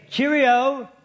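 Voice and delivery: high, sing-out mock-English call; put-on cheery English greeting; hammed-up English salutation, pitched high; English imitation. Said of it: "The English have this saying".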